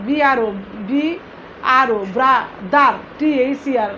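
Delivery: "ব্রাদার" spelled out slowly, part by part; slow teacher's word breakdown; broken into syllables. Shown as a demonstration. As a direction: separate the two syllables of "ব্রাদার", each with its letters. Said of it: "ভেঙে ভেঙে উচ্চারণ করতে".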